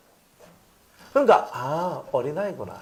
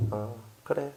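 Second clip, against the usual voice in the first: much softer, lofty dismissive tone; smug condescension; 3 semitones higher, 9 dB quieter than usual. Retold or imitated. Pastor mimics a proud believer dismissing others. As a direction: say it from above, smugly. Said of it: "자기가 하나님 다 돼 있어".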